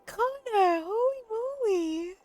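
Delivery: high and choked up; tearful. Real, not acted. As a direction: voice cracking with happy tears. Overwhelmed by a big gift.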